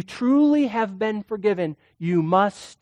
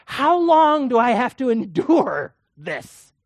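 fed up; 5 semitones higher, 5 dB louder, slower than usual. Retold or imitated. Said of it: "you've had it".

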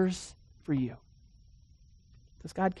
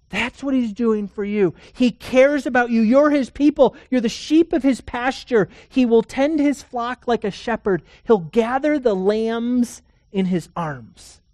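rising, louder pulpit voice, building; fervent preaching; 5 semitones higher, 6 dB louder than usual. Acted. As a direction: preach it out with growing fire. Preaching builds to full force.

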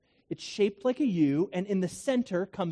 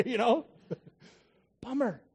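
normal talking voice

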